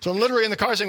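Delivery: ordinary talk, nothing marked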